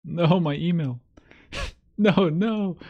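real jolt of alarm at the screen; alarmed cry. Real, not acted. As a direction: blurt it out in sudden panic. Panicked at own email on screen.

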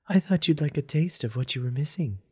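high, teasing female character voice. A narrator's reading. Narrator voices flirting ex.

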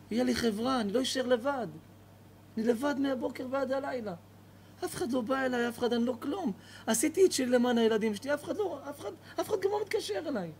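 high, tearful lonely lament; old man weeps, pitch rising as he breaks; crying old man. Acted. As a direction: plead through tears, voice climbing. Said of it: "הזקן הזה התחיל לבכות".

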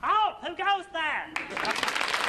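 sharp high halt, then a slow drawn-out question; sentry challenge. Acted.

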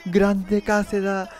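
high, slow drawn-out cheer; overjoyed fan crying out; elated cheering. Real, not acted. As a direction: stretch each name in celebration. Celebrating team and keeper.

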